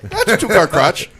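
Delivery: loud, very high granny squawk; hammed-up German granny, high and loud; old German lady. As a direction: holler back as an old German woman. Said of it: "when the old German lady's like".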